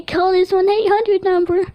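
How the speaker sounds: high, laughing echo of the cartoon host voice; mimicking the apple. Dreamer joking to his family in the apple's high voice. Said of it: "I was like".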